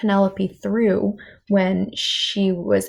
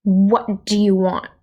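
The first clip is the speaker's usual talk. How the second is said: angry; 6 dB louder than usual. Acted. Angry princess bursts in.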